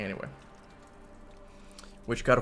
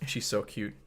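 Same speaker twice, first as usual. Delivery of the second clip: ordinary delivery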